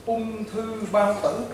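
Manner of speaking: slow, deliberate word by word, "Ung thư bao tử" drawn out syllable by syllable; said slowly and plainly. Shown as a demonstration. Healer naming the patient's illness simply, slow and deliberate. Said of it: "dễ hiểu nhất nha".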